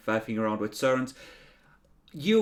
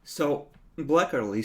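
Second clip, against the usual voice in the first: usual speaking voice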